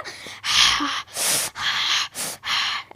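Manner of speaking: long breathy h from the throat like after chili; a burning-throat exhale; throaty breath. Shown as a demonstration. Teacher imitating a chili-burned breath to show ha. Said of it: "ऐसे बोलेंगे ना".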